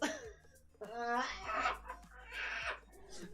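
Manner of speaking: high, drawn-out fake sobbing; mock breakdown wail. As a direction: fall apart in hammed-up cries. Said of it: "he becomes Mao, and then he goes".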